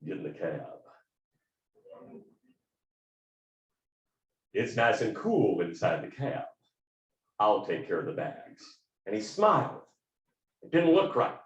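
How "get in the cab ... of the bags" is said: deep Texan voice. Retold or imitated. Cab driver welcoming a passenger in a deep Texan voice. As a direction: drop right down into a deep Texan bass. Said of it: "he says in this deep Texan accented voice".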